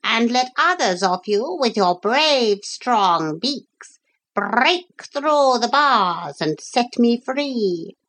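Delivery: high, declaiming parrot voice; parrot giving orders. Acted. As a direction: command grandly in a high bird voice. Parrot gives the gulls orders.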